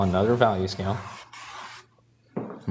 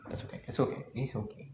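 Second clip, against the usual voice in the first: whispered self-reassurance; 3 semitones higher, 10 dB quieter, faster than usual. Real, not acted. Calming himself after the smudge.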